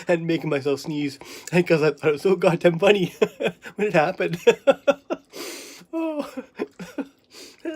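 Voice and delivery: real chuckles between the words; laughing. Real, not acted. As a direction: talk through rising laughter. Helpless amusement at own sneezing game.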